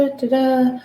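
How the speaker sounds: usual speaking voice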